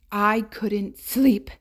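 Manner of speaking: ordinary delivery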